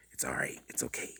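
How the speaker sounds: soft whispered comfort; soothing self-talk; hushed reassurance. Real, not acted. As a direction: whisper it gently to yourself. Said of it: "soothing himself".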